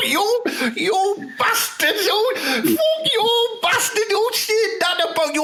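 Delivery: squealing laughter, very high; laughing outrage. Acted. Footballer laughing and swearing at his teammate. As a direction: shriek through helpless laughter.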